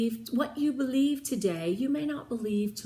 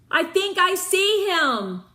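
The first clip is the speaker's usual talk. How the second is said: eager call; 8 semitones higher, 7 dB louder than usual. A narrator's reading. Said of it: "Jen said".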